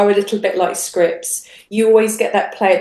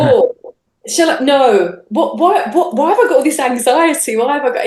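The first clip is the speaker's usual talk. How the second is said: mock-anxious self-talk, higher; anxious inner dithering; 5 semitones higher, 4 dB louder than usual. Retelling her nerves before uploading, mock-anxious dithering. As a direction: voice her own panicky doubts, higher pitched.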